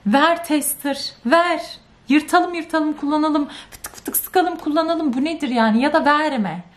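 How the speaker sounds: high, heated complaint; exasperated rant. Real, not acted. Vlogger scolding the brand for bad testers, heated and high. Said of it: "Şunlara çok öfkelendim".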